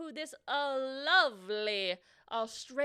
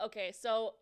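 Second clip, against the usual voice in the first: ordinary talk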